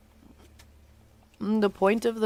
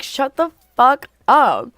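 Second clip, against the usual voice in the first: slow, loud, higher mock snap; annoyed retort; 6 semitones higher, 6 dB louder, slower than usual. Retelling telling her friend to shut up, slow and loud. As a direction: drag it out, fed up. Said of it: "And I would be like".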